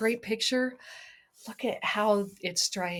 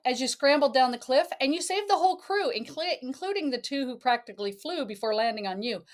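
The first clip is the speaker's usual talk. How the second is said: lilting read-aloud storybook voice; sing-song picture book reading; reading to a child; 4 semitones higher, faster than usual. Acted.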